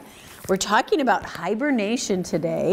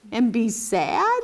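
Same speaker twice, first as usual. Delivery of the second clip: ordinary delivery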